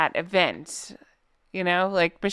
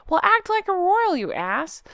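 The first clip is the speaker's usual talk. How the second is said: exasperated scolding; 10 semitones higher than usual. Real, not acted. Scolding retort.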